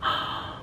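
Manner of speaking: a quick emotional intake of breath; sharp gasp. Real, not acted. Overwhelmed by her result, a sharp gasp. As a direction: gasp in disbelief.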